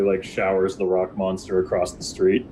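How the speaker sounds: usual speaking voice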